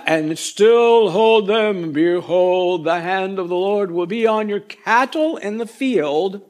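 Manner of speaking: slow, drawn-out commands as the Almighty; God voice. Acted. Preacher reads the Lord's command to Pharaoh in a God voice. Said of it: "I say it in a God voice".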